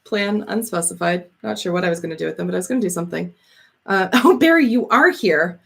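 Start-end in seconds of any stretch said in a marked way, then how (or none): none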